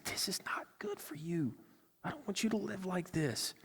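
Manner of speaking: hushed loving warning; tender whisper. Acted. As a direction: speak it like soothing a loved one. Loving correction voiced gently.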